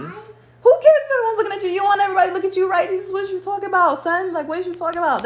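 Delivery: high, rapid-fire acted telling-off; exasperated scolding. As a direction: jump in and challenge their doubts. Acts out scolding reply to doubts.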